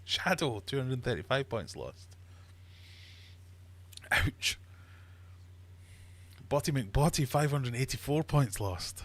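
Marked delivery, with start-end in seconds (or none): 4.1-4.6 breathy whispered ouch of sympathy. Acted. Quizmaster wincing at a player's big loss, whispered ouch. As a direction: whisper it like you felt the pain. Reacting to lost points.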